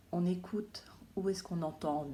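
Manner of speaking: whispered instruction to the class. Real, not acted. Teacher whispering to the children. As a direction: breathe it without voice. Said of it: "whispered instruction".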